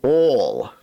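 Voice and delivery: oil said as all, like a Texan; oil in a Texas accent, rhymes with all; Texas drawl on oil. Retold or imitated. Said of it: "they pronounce oil".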